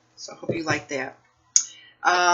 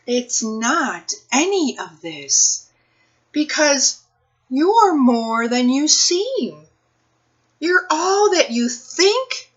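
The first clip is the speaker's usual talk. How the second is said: lively, sing-song picture book read-aloud, slower and higher; reading to children; 5 semitones higher, 7 dB louder, slower than usual. Acted. Librarian reading a picture book to children, expressive storytime voice. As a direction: read it like storytime for little kids, savoring each rhyme.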